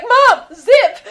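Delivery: high, loud laughing yell; Scott yelling. Retold or imitated. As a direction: shriek the order through laughter. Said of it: "he's like".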